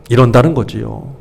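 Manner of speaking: ordinary talk, nothing marked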